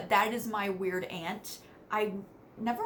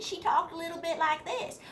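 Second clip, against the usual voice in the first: higher, lightly put-on West Virginia accent; old-fashioned southern accent; 9 semitones higher than usual. Shown as a demonstration. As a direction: slip into a light Appalachian accent. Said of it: "she had a little bit of an accent".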